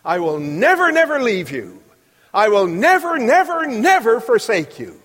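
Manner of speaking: emphatic booming quote. Retold or imitated. Preacher quoting Spurgeon to the congregation, booming and emphatic. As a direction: pound every never like a preacher in full flight. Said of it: "the most emphatic verse in the Bible".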